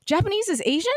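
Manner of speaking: high, puzzled question; imitated disbelief. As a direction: mimic someone genuinely baffled by news. Retelling man's disbelieving question.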